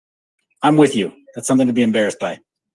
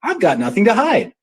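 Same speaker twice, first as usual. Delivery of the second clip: mock stubborn quote; 9 semitones higher than usual. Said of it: "always like".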